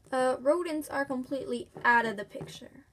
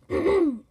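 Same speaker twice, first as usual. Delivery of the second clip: clears throat; 4 semitones higher, 5 dB louder than usual. Acted. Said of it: "Now we gotta work on our voice".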